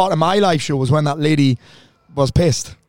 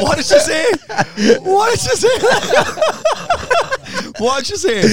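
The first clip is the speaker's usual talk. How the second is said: laughing; 3 dB louder than usual. Real, not acted. Host cracking up at impression.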